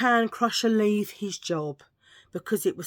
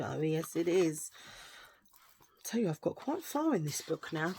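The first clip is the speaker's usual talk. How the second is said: ordinary talk, nothing marked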